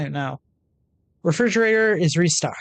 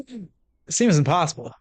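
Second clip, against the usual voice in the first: ordinary delivery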